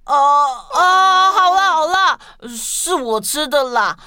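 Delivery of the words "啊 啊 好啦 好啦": flustered outburst. Acted. Caught out, gives in.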